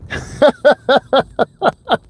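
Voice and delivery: high-pitched giggle; a quick breathy high laugh. Real, not acted. Laughs at own sneaky eating.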